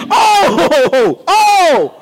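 OH stretched long and high; emphatic oh. Shown as a demonstration. Preacher demonstrating to the congregation the difference, fervent long OH. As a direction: belt out OH with rippling fervor. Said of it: "Makes a difference, don't it?".

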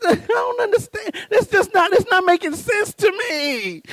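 high, half-crying bewilderment; baffled whine. Acted. Husband baffled that hungry women don't eat, whining. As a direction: whine it like a man at his wits' end. Acts out his bafflement at dinner.

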